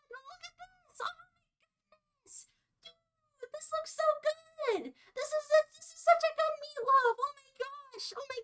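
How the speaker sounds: high, thrilled squeal over the meatloaf; high squealing delight. Real, not acted. To chat, showing off her finished meatloaf, high thrilled squeal. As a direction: gush over it like it's a treasure.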